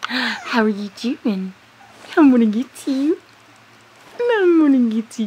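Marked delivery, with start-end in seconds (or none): 2.2-5.3 louder, fast playful threat; mock-chase the little one, louder; playful teasing. Real, not acted. Playful chase of baby animal.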